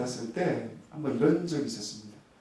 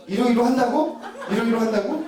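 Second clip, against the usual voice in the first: higher, louder, rising 한다고 in disbelief; indignant echo; 7 semitones higher, 7 dB louder than usual. Retold or imitated. Wife repeating husband's jab in disbelief. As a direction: throw his words back at him. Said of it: "아내가 저에게 이런 얘기를 했어요".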